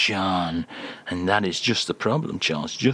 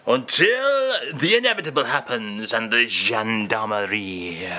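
put-on a light comic French accent on the English. Charles, host's French guest, in a light mock French accent.

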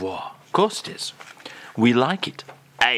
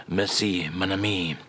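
lower, softer French thanks; mock French accent; 6 semitones lower, 4 dB quieter than usual. [name] thanks the host before the phrase, in a mock French accent. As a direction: thank him like a gracious Frenchman.

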